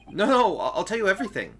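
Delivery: loud, high frantic begging; loud scared pleading; panicked pleading. Acted. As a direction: blurt it out in terror.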